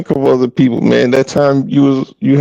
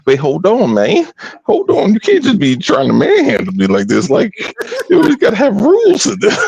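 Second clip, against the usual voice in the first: high-pitched imitation of a flustered man; mock panicked protest; 10 semitones higher than usual. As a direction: plead for time, voice climbing high. Acts out Bone's panicked protest.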